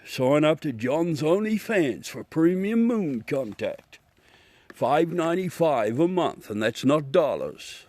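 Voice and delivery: slow-starting, playful advertising announcer; mock promo plug. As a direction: pitch it like a joke sales spot. Joke promo plug for premium content.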